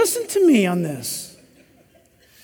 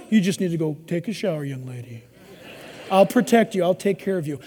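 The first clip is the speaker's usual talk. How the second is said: much lower, soft reassuring murmur; tender protective; 8 semitones lower, 6 dB quieter than usual. Retold or imitated. Boyfriend sending his date home, low soft reassurance. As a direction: send her home gently, protecting her. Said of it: "He tells her".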